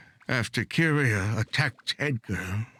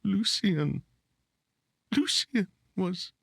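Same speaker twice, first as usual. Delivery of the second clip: distressed, breaking off; 9 semitones higher, slower than usual. Acted. Brother recalls finding the body.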